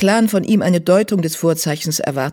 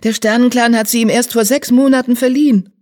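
raised, higher voice of a distressed queen; urgent acted outcry; crying out; 7 semitones higher, 6 dB louder than usual. A narrator's reading. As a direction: protest loudly, pitched up. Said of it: "rief die Königin".

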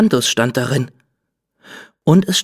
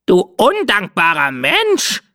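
high, slow, indignant parrot squawk; wounded parrot pride; offended parrot; 7 semitones higher, slower than usual. Acted. Parrot Cinzia scolding the ungrateful human, high indignant squawk.

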